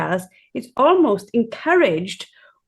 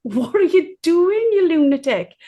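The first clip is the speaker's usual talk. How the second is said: mock self-scolding; 8 semitones higher than usual. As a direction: laugh at yourself in disbelief. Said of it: "I laugh at myself".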